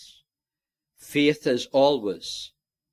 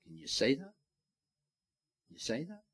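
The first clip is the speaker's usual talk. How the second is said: softer, searching question; quiet appeal; 14 dB quieter, much faster than usual. Real, not acted. Preacher softly asking his congregation, quiet and searching. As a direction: drop the voice and ask them personally.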